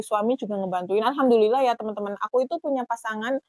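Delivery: normal talking voice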